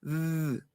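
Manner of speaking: soft "Z" made with tongue between teeth; buzzed th sound; interdental buzz. Shown as a demonstration. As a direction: sustain a voiced TH. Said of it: "la lengua entre los dientes".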